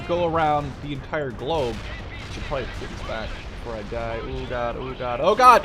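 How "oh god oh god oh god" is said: rising alarm, louder at end; real panic as units die; escalating oh god; panicked oh god. Real, not acted. Game loss while playing.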